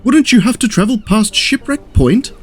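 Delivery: high, worried question; concerned. A narrator's reading. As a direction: ask anxiously in a lifted voice.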